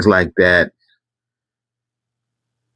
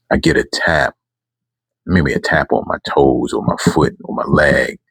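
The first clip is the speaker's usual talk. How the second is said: ordinary delivery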